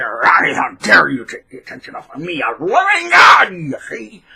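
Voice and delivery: hammed-up pompous narcissist, high and loud; mock outrage from a self-declared god. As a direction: shout it like a self-important showoff. Voicing narcissist in mocking tone.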